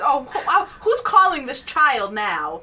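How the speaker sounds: hammed-up indignant outburst; mock indignation. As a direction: demand it in exaggerated outrage. Voicing an indignant reaction to caller.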